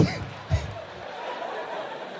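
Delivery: high breathy laugh; high cackle. Real, not acted. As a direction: burst into a shrill cackle. Laughing at own joke.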